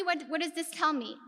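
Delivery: ordinary talk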